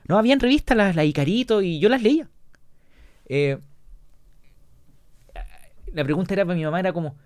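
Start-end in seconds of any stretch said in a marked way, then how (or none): 0.1-2.3 high, louder insistent mother's voice; mom insisting. Retold or imitated. Mother insisting to her daughter she learned from magazines, high and insistent. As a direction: insist like a proud mom defending herself. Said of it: "y me decía".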